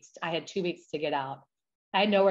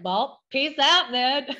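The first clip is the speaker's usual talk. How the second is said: higher, louder, drawn-out farewell; breezy mock send-off; 7 semitones higher, 6 dB louder, slower than usual. Telling how she walked out on her mom, breezy mock send-off. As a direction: toss it off as a cheeky goodbye. Acts out her defiant parting words.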